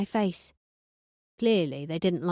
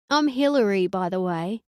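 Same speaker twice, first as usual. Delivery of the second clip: giggly Hillary. Acted. Narrator as Hillary greeting Conan, giggly girly voice. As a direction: introduce herself as a ditzy girl. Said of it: "the way a Hillary would giggle".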